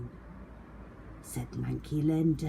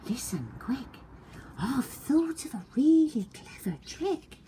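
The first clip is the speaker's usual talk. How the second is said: high, eager little bug; a tiny creature with a plan; tiny ladybird voice; 9 semitones higher than usual. A narrator's reading.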